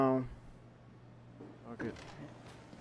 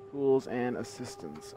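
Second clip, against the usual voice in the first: usual speaking voice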